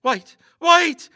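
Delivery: frightened stammer rising to a loud high cry; stammering fear. A narrator's reading. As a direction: stumble over the words, then call out in panic. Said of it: "stammered Lester".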